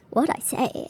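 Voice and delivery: soft, thrown-off mumble; half to herself, caught off guard; a quiet confused echo of the question; baffled murmur. Retold or imitated. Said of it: "I remember being like".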